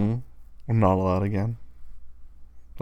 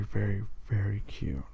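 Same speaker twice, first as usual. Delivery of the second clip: slow admiring whisper; 4 dB quieter than usual. Acted. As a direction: linger on it, whispered. Admiring her socks.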